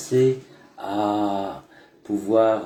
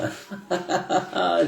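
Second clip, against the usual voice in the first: laughing; 9 semitones higher than usual. Real, not acted. Laughs after intro.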